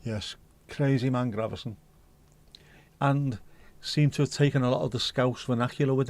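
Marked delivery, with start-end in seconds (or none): none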